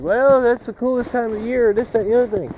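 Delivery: high, sneering mock of critics. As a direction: parody them with a whiny sneer. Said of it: "some people are like".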